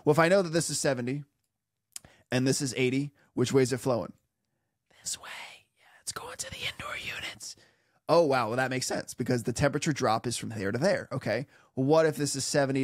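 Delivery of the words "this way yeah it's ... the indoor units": whispered aside. Real, not acted. Hushed answer to own question.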